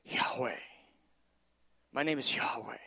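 low, soft divine revelation; hushed reverence. Acted. God telling Moses his name, hushed and reverent. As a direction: reveal the holy name softly and low.

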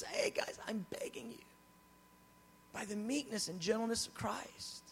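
whispered, tender pleading. Retold or imitated. Apostle begs his beloved believers, in a tender whisper. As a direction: plead softly in a hush, tender and meek. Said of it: "he is tender".

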